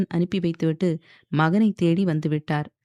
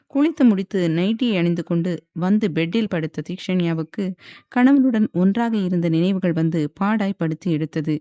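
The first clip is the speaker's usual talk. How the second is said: ordinary delivery, nothing marked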